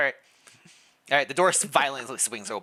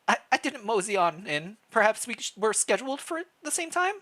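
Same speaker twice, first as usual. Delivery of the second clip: higher and higher, flustered protest; nervous, rising confusion; flustered reply; 10 semitones higher than usual. Acted. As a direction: stammer it out, climbing in pitch. Voicing Link, flustered reply.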